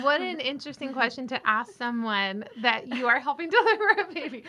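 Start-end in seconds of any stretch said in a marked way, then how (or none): 3.5-4.5 laughing. Real, not acted. Laughs at the odd question.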